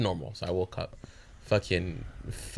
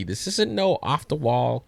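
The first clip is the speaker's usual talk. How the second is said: ordinary talk, nothing marked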